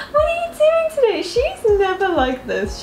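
high-pitched, loud squeal of fond disbelief; exclaiming at pet. Real, not acted. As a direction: squeal at the cat, voice way up. Talks to her playful cat.

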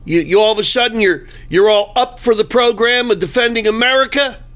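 retold taunting shout at Chucky outside a stadium; retold yelling. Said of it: "I started yelling".

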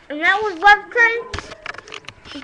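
loud, slow sign-off; show host outro. Pretend. Host closes the pretend show, loud slow sign-off. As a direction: wrap up like a TV host.

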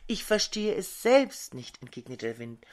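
plain reading voice

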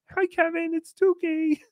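high-pitched put-on greeting; Tookie's voice. Introducing himself to Kevin, high put-on Tookie voice. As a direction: pipe up in a high character voice. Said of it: "Just say it in my voice".

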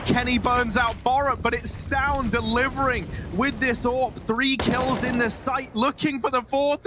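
rising excited play-by-play; esports caster. Real, not acted. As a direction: call the round as it heats up. Climax of live round call.

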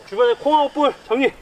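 usual speaking voice

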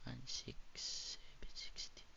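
slow whisper, counting bills. Real, not acted. Streamer quietly counting cash before a giveaway, slow whisper. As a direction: whisper the numbers to yourself. Counting money under breath.

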